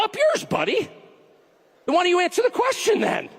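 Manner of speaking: high-pitched, indignant comeback; irritated retort. Acted. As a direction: throw it back at them, indignant. Retorts to an absent opponent.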